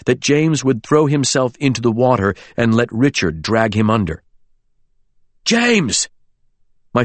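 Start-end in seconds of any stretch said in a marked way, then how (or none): 5.5-6.1 high, alarmed cry; frantic call. A narrator's reading. Friend calls James back from the water, frantic. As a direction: call him back in panic. Calling to friend running into danger.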